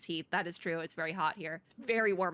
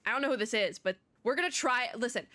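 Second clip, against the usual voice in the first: ordinary delivery, nothing marked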